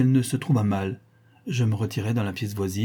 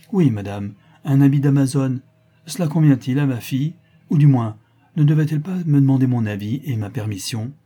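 loud, reproachful questioning; indignant father; 4 semitones higher, 7 dB louder than usual. Acted. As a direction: scold as an affronted parent. Father feigns reproach over his daughter's costume. (père indigné, reproches à voix forte)

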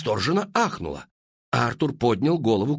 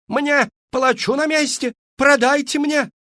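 very high loud yells; frantic cries; 6 dB louder than usual. A narrator's reading. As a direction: shout over the crowd to buy. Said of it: "истошные крики". (истошные высокие крики)

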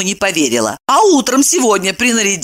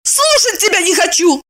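high, heated refusal; angry refusal; 12 semitones higher than usual. A narrator's reading. Evdokia refuses her friend's story, high and angry. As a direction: refuse to listen, pitched high.

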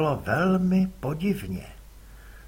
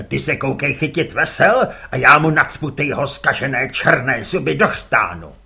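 louder gruff threatening voice; gruff threat; 8 dB louder than usual. Acted. Father orders his son and threatens rival, gruff. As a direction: growl the threat.

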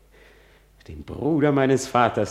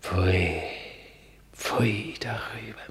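whispered, contemptuous pfui; disgusted whisper; 4 dB quieter than usual. Acted. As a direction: spit it out under your breath. Soliloquy, disgust.

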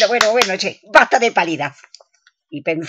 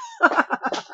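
high breathy laugh; 10 semitones higher, 6 dB quieter than usual. Acted. Laughs at own joke.

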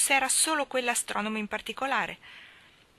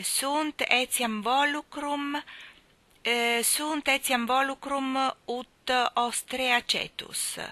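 slow, halting schoolboy recital; haltingly reciting a memorized lesson; slow recitation; 6 semitones higher, much slower than usual. A narrator's reading. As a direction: drag the Latin out word by word. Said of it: "disse Tom molto adagio".

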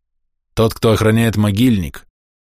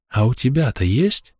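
insinuating; 4 semitones higher than usual. Acted. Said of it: "вкратчиво спросила Лёля".

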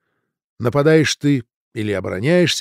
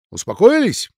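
curt question; 9 semitones higher, 7 dB louder than usual. A narrator's reading. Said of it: "уточнил Постольский".

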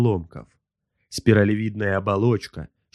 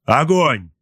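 higher, louder order to fire; raised-voice battle command; firing command; 5 semitones higher, 5 dB louder than usual. A narrator's reading. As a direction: call out the launch order sharply. Captain orders torpedo launch.

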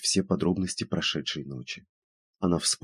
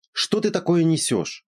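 much higher, exasperated question; losing patience; 11 semitones higher, 4 dB louder than usual. A narrator's reading. Friend doubting the dream story, losing patience. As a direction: demand it as patience runs out. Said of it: "Подруга начала терять терпение".

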